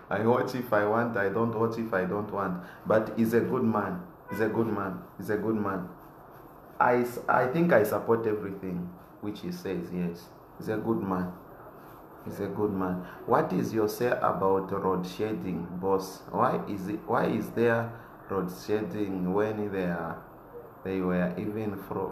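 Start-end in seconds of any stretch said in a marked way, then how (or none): none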